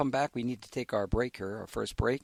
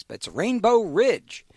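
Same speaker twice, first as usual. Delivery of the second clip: Ridge hit high and loud; correcting stress; 8 semitones higher, 8 dB louder than usual. Real, not acted. Host correcting the book title, Ridge stressed. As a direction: land hard on the fixed name. I misspoke.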